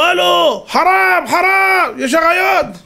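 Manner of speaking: high, urgent mock warning shouts; acted shouting. Policemen warning the rabbi of loose lions, acted shouting. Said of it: "כל השוטרים צועקים לו".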